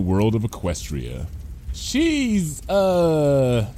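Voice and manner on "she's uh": high, hesitant, stopping short; stretched, uncertain words; faltering. A narrator's reading. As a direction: falter and trail into a stop. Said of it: "voice came to a faltering stop".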